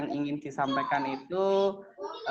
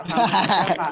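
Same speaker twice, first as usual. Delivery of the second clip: laughing; 2 semitones higher, 6 dB louder than usual. Real, not acted. Permits smoking, laughing.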